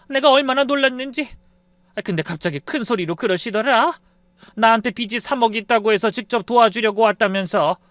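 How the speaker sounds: put-on rattled older woman retelling her shock; acted mother-in-law, aghast and agitated; shuddering mother-in-law. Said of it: "오금이 저린다는 듯 몸서리치며 말씀하셨어요".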